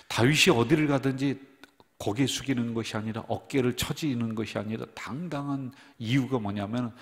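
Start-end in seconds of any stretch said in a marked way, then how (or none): none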